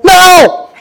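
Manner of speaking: very high, loud shriek of no; toddler's sudden no. Retold or imitated. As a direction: snap from cuddly to refusal. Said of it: "and be like".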